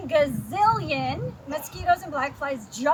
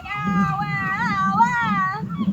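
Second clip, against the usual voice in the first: rising cries of pain, last one high; ow getting higher each time; yelling ow; 4 semitones higher, 3 dB louder, much slower than usual. A narrator's reading. Bear under the bugs cries out, rising yelps. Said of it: "something was yelling".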